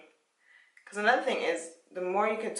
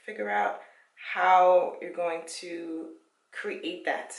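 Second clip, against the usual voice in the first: ordinary talk, nothing marked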